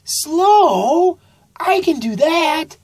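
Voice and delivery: high, loud happy cry; Sammy thrilled to be told to go slow; excited exclaim. A narrator's reading. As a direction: burst out with delight. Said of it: "exclaimed Sammy Sloth".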